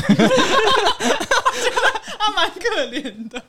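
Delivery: slow words squeezed out through laughter; laughing so hard the words drag. Real, not acted. Laughing at her friend's silly habit, slow and giggling.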